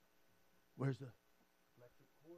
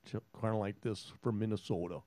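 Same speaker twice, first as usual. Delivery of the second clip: Minnesota accent; 6 semitones lower, 15 dB quieter than usual. Shown as a demonstration. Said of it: "Here's my actual accent".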